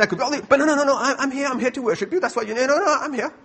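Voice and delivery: high, loud, insistent self-justification; frantic protesting. Acted. Churchgoer insisting to Jesus that he is here to worship, frantic protesting. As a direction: protest in a high tumble of denials.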